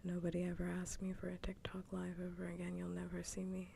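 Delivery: ordinary talk, nothing marked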